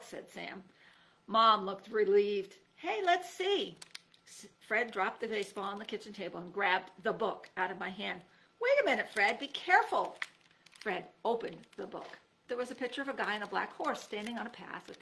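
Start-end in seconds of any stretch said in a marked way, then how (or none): 2.8-3.8 excited. A narrator's reading. Fred eager, high voice. 8.6-10.2 urgent warning. A narrator's reading. Narrator warns Fred.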